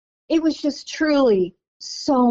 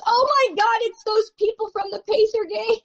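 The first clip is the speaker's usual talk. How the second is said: pitched way up in delighted surprise; a sudden high "oh my God" of recognition; surprised recognition; 11 semitones higher than usual. Retold or imitated. Joe Dumars spots the Pacer fans at airport, high surprised. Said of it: "and he goes".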